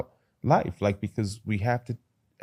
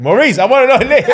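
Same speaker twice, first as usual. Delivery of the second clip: higher, louder insistent demand; pushy demanding; 14 semitones higher, 13 dB louder, faster than usual. Retold or imitated. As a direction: press him like a pit bull. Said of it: "I'm being a pit bull now".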